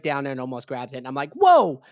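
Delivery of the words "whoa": very high, loud, rapid-fire panic; alarmed yelling. Retold or imitated. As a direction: yelp it to fend off a grab. Retold alarm as she grabs.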